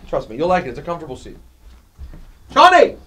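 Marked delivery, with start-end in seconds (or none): 2.5-3.0 high, loud name shout; sudden loud summons; calling out. Real, not acted. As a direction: holler for someone across the studio. Calling out to studio staff.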